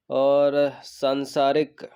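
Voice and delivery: slow, drawn-out और, then सांसारिक spaced out; careful word reading. Shown as a demonstration. Teacher checking options for students, slow careful सांसारिक. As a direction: stretch और and spell out सांसारिक by ear. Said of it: "ये तीनों सही लिखे हैं".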